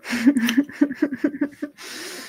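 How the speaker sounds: short giggle at herself; embarrassed laugh. Real, not acted. Laughs at eating on camera.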